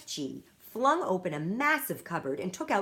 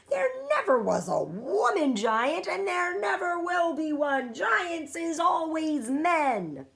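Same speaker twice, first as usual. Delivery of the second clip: much higher, louder giant shout; 9 semitones higher, 5 dB louder, slower than usual. A narrator's reading. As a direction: keep shouting, slowing on the end. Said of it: "shouted the B F G".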